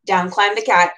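ordinary delivery

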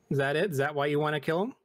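ordinary talk, nothing marked

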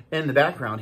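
ordinary delivery, nothing marked

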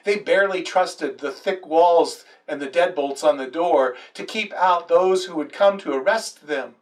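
ordinary delivery

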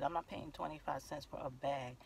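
softly, evenly, no argument; calm and quiet. Retold or imitated. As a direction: keep it calm and low-key, never raise the voice. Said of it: "I talk very calmly".

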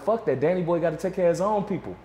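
heated mock tirade. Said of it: "[name] was like".

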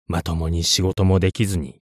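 ordinary delivery

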